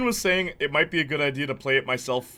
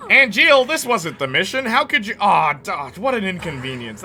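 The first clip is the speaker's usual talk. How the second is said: mock-dramatic, loud and high, easing off by the end; new guy's voice; 5 semitones higher, 7 dB louder than usual. Streamer mocking the new voice actor, loud overwrought delivery. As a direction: overplay the reproach, then deflate. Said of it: "That's how this new guy sounds".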